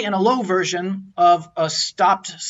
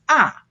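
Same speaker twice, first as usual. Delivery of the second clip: "ah" starting high and dropping sharply; forceful falling tone; 5 semitones higher, 4 dB louder than usual. Shown as a demonstration. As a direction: come down forcefully on "ah". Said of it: "start really high and come down forcefully".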